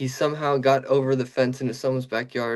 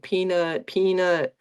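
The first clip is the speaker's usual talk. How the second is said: higher, calling-out name, falling at the end; calling for a dog; 7 semitones higher, slower than usual. Retold or imitated. Mom and her sons searching for their lost dog, calling out. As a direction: call the lost dog's name down the street.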